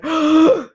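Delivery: loud high shocked gasp; sharp gasp. Real, not acted. Rancher spotting the dairy bull, sharp gasp. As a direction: gasp in sudden alarm.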